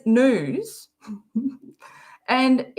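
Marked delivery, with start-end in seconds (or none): 0.1-0.9 "news" stretched out as "nooz"; imitated pronunciation. Streamer asking viewers who says it wrong, imitated nooz. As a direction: copy him saying "news" with no y sound. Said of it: "He says news".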